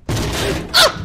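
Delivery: high, loud breathy scream; real startled shriek. Real, not acted. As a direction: shriek in fright. Scream in basement.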